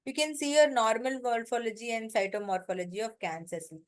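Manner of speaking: ordinary talk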